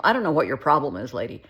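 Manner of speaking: loud, confrontational retort in a retelling. Retold or imitated. Shoplifter to the shopper who caught them, mock confrontational. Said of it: "got a little, you know, confrontational".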